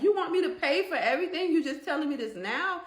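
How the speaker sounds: retold outrage at a sudden demand; incredulous. Woman to her sister-in-law over being asked to pay, incredulous.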